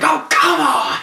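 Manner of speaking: high, loud protest; exasperated shout. Retold or imitated. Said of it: "Paul Heyman's like".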